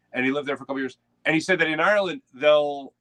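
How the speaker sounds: ordinary talk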